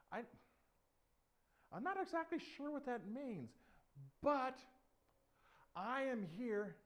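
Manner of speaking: high voice with halting pauses; hesitant little David. Acted. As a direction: answer in a small high voice, gathering courage.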